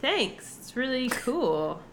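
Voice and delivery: fake-gracious thanks with a hint of suspicion; lightly voiced bright politeness; polite Gwyn. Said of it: "She's like".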